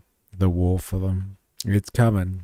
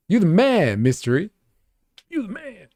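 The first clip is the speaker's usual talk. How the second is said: hype; 9 semitones higher, 4 dB louder than usual. Real, not acted. Hyping up guy in clip.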